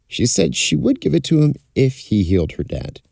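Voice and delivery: ordinary delivery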